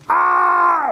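high, loud rabbit scream; frightened scream. Acted. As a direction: scream high in mock terror and run.

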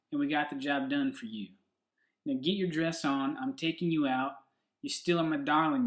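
amateur put-on Southern accent; best Southern accent. Shown as a demonstration. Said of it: "do it in my best Southern accent".

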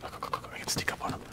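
fast, very hushed whisper; excited stealth whisper; sneaky whisper. Real, not acted. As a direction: whisper quickly as you creep up. Sneaking up on enemy.

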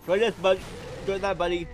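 loud, high taunt at an enemy; combat taunt. Real, not acted. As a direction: yell it at your opponent. Attacking an enemy in game.

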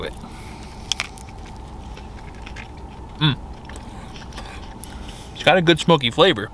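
talking between bites; chewing food while talking. Real, not acted. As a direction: mouth partly full. Seen 1: Bites at -3s, chews through early words; later just holds tlayuda.

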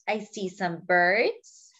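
ordinary delivery, nothing marked

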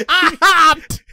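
laughing. Real, not acted. Laughing at own mistake.

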